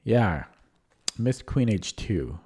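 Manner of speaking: ordinary delivery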